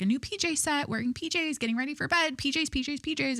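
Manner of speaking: high, repetitive mock sing-song listing; mocking recital. Podcaster mocking a woman's endless pajama posts, mocking.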